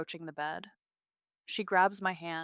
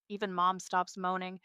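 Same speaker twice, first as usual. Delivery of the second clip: ordinary delivery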